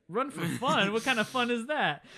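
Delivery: loud, high, scoffing imitation; mock incredulity. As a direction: scoff loudly at a ridiculous idea. Townsman mocks Doc's future recreation.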